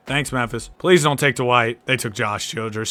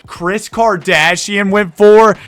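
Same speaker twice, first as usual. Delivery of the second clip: high, loud, slow exclamation; loud, drawn-out cry of excitement; relieved shout with voice crack; 9 semitones higher, 11 dB louder, much slower than usual. Real, not acted. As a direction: yell it out slowly till the voice cracks. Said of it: "that voice crack was nuts".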